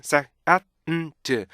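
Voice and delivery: SAND slowly split into sa-a-n-d; "sand" with every sound given its own beat; drawn-out sand. Shown as a demonstration. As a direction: stretch "sand" into separate beats. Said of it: "보세요".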